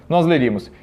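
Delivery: ordinary talk, nothing marked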